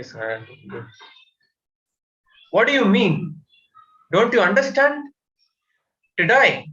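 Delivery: normal talking voice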